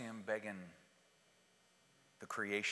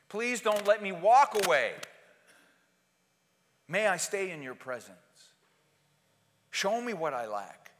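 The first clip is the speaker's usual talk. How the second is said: loud, high fervent preaching; thundering pulpit voice; full-force preaching; 9 semitones higher, 11 dB louder than usual. Acted. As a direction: preach it out to the back row.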